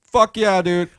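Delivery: loud, high excited shout. Real, not acted. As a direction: burst out with excitement. Excited about ghost evidence.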